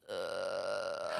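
low, choked rattle from deep in the throat; zombie throat gurgle. Shown as a demonstration. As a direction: gurgle like phlegm stuck in your throat. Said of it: "人家喉咙有痰一样".

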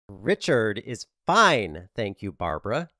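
slow, drawn-out, superior; condescending. Acted. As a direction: talk down to her slowly. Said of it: "in a condescending tone".

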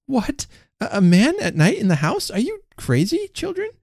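high-pitched, stammering astonishment; shocked disbelief. A narrator's reading. Mother questioning her children, incredulous.

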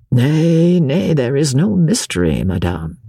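ordinary delivery

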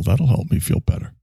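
ordinary delivery

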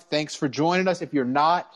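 usual speaking voice

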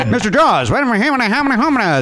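hammed-up high novelty-record voice. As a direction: imitate a comic record, pitched high. Mimics the Mister Jaws novelty record.

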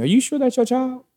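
high-pitched imitation of a doubting grandmother; grandmother's pointed question. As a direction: ask it pointedly, pitch leaping up. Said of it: "That's why her grandmother asked me".

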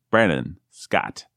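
high, long drawn-out final syllable; hyped announcer introduction. Pretend. Host grandly introduces his co-host by name, hyped announcer style. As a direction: stretch the name out like a ring announcer.

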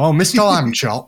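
slow, lightly northern English Mr Lambchop; northern accent. Acted. Dad reading Flat Stanley, Mr Lambchop in a mild northern accent. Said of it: "So he's northern".

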